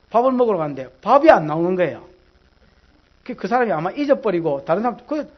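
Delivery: usual speaking voice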